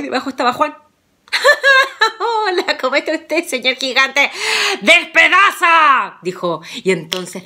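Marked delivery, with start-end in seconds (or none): 1.3-2.1 high, loud sheepish laugh; nervous giggle. Acted. Juan caught under the table, nervous high giggle. 2.2-4.3 higher greeting through a nervous laugh; sheepish laughing greeting. Acted. Juan greets the giant who found him, laughing nervously. 4.8-6.2 loud, slow, higher battle shout; attack command. Acted. Juan commands his dog to attack, a loud slow shout.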